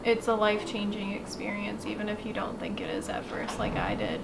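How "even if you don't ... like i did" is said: breathy whispered aside; whispering. Real, not acted. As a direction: drop to a whisper. Trails off into whisper.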